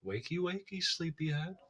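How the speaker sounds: soft, gentle wake-up call; calm. Acted. Player's character waking someone who regained consciousness, calm and soft. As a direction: coax a sleeper awake, hushed. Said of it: "I'm gonna calmly say".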